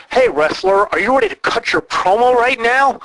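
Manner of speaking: mock a hammy backstage interviewer; sing-song fake TV announcer; cheesy interviewer. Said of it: "why are we talking like that?".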